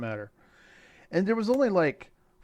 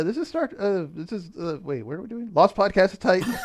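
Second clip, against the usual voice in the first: ordinary talk, nothing marked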